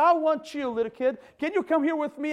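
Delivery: put-on calling out to the kid.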